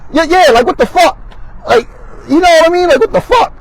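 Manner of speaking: can't believe it, voice jumps way up; high-pitched what-the-fuck in outrage; incredulous outburst. Real, not acted. Disbelief at the harsh sentence.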